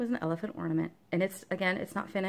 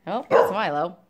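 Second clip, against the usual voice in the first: a touch of a gushing voice for the dog, louder; pet voice; 7 dB louder, slower than usual. Real, not acted. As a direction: coo at the dog, slow and loud.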